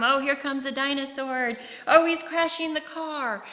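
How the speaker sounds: child voicing toys in pretend play. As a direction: narrate a dinosaur crash like a kid at play. Child narrating toy play.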